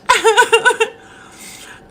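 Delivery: loud, high burst of laughter with a snort; real snorting fit of laughter. Real, not acted. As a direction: crack up and snort. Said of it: "Yes, I snort when I laugh too much".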